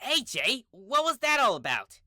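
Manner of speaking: high, annoyed demand; a bit annoyed at being shoved down. Acted. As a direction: ask it sharply, put out. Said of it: "sounding annoyed".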